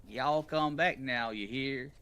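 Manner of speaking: sweet-talking Southern country wizard; Southern country accent. Pretend. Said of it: "I'm, I'm a country wizard".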